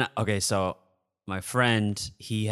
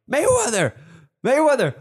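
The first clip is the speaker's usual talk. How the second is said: starstruck nerd; 6 dB louder than usual. Retold or imitated. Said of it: "I turned to a fucking nerd".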